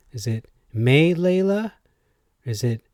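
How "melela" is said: "Melela" as MAY-lay-la, high questioning rise; stress on first syllable. Shown as a demonstration. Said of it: "put a different emphasis on words".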